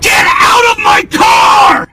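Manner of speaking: screaming. Real, not acted. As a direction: scream it out, slow and furious. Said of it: "You have the right to scream at me".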